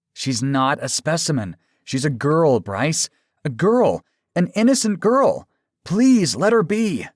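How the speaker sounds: high, loud protest, building to a plea; impassioned pleading. A narrator's reading. Aaron defending the girl to his lover, impassioned.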